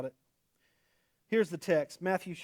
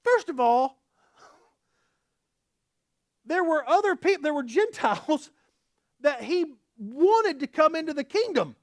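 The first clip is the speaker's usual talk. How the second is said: high, loud sermon build; impassioned preaching; 9 semitones higher, 7 dB louder than usual. Acted. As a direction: press the hard question on them.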